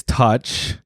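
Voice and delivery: ordinary talk, nothing marked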